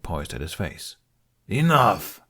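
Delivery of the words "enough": sharp commanding shout to stop the fight. A narrator's reading. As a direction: bark it out, loud and higher. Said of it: "Domokos shouted".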